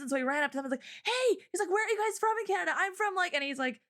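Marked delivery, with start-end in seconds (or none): 1.0-1.7 high, eager call-out in imitation; bright-eyed greeting, pitched way up; retold excitement. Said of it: "he got so excited". 1.7-3.3 high, rapid, eager questioning; fast and high with excitement; retold excitement. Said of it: "he got so excited".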